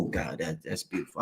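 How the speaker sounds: very low, soft murmur; hushed awe. Real, not acted. Savouring being the activity of God, hushed and low. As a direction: let it sink almost to nothing, as if savouring it. Moved by his own declaration.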